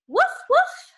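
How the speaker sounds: high yappy puppy woof; puppy bark. Acted. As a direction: bark like a small happy dog. Dog barks in the story.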